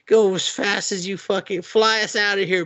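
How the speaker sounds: high-pitched urgent command, retold; comic imitation of spurring on a mount; mock urgency. Hero urging reindeer to flee, high mock urgency.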